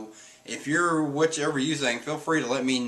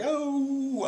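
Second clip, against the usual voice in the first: high, drawn-out mock wail; mock dismay; 14 semitones higher than usual. Caribou realizing he boarded alone, high mock dismay. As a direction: stretch it high like a dawning disaster.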